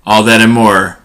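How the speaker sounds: measured headline read, pausing between items; slow, deliberate podcast teaser delivery; show intro read. Acted. Host teasing this week's topics to listeners, announcer-style intro read. Reads the show's opening teaser.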